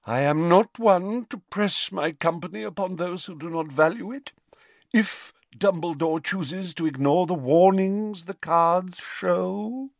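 high, lofty offended seer; Professor Trelawney, haughty. A narrator's reading.

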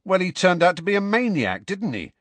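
high, heated schoolboy comeback; hot retort. A narrator's reading. Student Dean answering the professor about her predecessor, hotly. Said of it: "said Dean hotly".

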